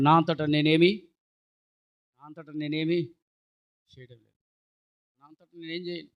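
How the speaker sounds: lightly voiced Jesus, gentle and slow; quoting Jesus. Preacher quoting Jesus to the church, soft and slow. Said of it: "ప్రభు అయిన యేసే అంటాడు".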